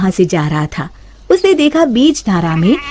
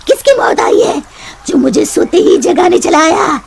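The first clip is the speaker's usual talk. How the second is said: high snarling rebuke; woken witch anger; 6 semitones higher than usual. Acted. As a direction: scold the intruder who woke her.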